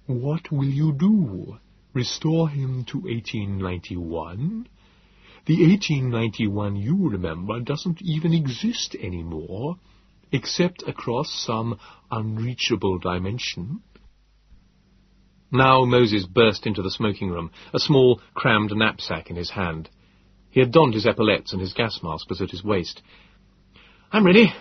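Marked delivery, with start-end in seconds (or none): 0.1-13.8 calm alien reasoning. Acted. Nebogipfel reasoning with the narrator about Philby, calm. 24.1-24.5 breathless, higher and louder; gasped. Acted. Moses bursting in after packing, breathless. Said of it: "he gasped".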